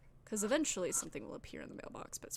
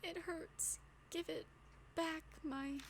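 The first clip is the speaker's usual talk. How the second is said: higher, soft, trembling ghost plea; shuddering voice; 7 semitones higher, 5 dB quieter, slower than usual. Acted. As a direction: quiver it out like a hurt ghost. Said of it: "a shuddering voice echoed".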